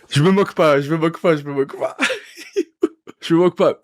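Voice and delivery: high-pitched, giggling through the words; trying not to laugh and failing; real laughter breaking through. Real, not acted. Cracking up at the track.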